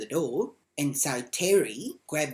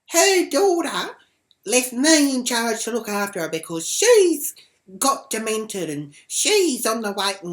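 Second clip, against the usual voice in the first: mocking high voice; 8 semitones higher, 10 dB louder than usual. Retold neighbour, high slow loud.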